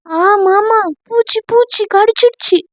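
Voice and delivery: high, squealing complaint; mock bug-bite cry. A narrator's reading. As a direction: squeal about a bite, hammed up. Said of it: "என்று கத்த".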